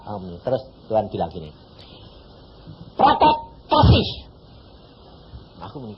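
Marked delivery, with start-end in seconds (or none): 2.9-4.1 slow, higher voiced divine command; slow, solemn word from God; preacher voicing God's instruction. God's voice in story.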